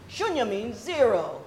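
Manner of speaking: usual speaking voice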